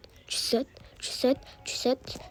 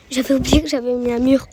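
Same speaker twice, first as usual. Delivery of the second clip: loud startled realisation; surprised; 7 dB louder than usual. Real, not acted. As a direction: blurt it out loudly in surprise. Bumps into forgotten wall after "Waouh.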